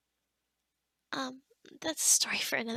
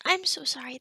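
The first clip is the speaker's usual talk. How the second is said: louder, startled acted apology; flustered apology; 8 dB louder than usual. Hastily apologising for sounding threatening.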